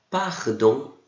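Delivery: "Pardon," with the R at the back of the throat; "Pardon," R rolled far back, nasal ending; modelling "Pardon," for the class; French R in pardon. Shown as a demonstration. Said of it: "R lá atrás".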